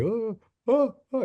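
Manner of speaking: high mock whimper; mimicking a heckler who backs down, high and scared. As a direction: squeak like a cornered bully. Mocks heckler backing down.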